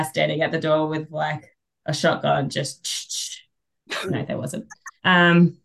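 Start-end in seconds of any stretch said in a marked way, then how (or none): 2.5-4.7 unvoiced whisper aside. Real, not acted. Guest takes back the shotgun joke, whispering. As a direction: whisper the retraction.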